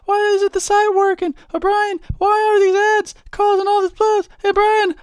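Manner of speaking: high, loud Southern falsetto; mock Southern accent. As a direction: complain in a squeaky Southern accent. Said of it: "such a southern accent".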